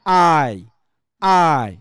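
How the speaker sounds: "আই" as a long falling glide; diphthong demonstration. Shown as a demonstration. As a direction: stretch "আই" slowly from AA into EE. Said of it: "এটা কি হবে আমাদের?". ("আই", টেনে diphthong)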